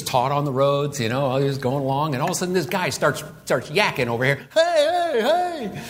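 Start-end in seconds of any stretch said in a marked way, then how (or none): 4.5-5.8 high-pitched imitation of a yell; mock yelling. Blind man yells over the teaching, mock yelling. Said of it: "he's yelling and screaming".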